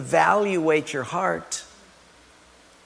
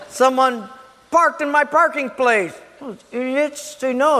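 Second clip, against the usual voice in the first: higher-pitched, affronted complaint; mock indignation; 6 semitones higher than usual. Churchgoer finding the parking place taken, mock indignant. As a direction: act out petty outrage. Acted quarrel over parking spot.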